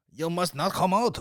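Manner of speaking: high, badly faked Japanese accent; vaguely Japanese accent. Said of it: "What is that vaguely Japanese?".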